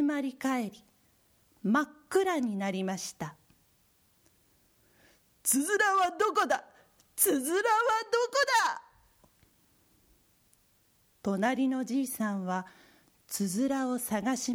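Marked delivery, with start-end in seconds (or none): 5.5-8.8 frantic. Acted. Searching in the dark.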